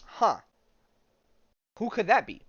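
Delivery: hammed-up curious voice; mock puzzled homeowner. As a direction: play the clueless guy at the door. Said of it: "you're like".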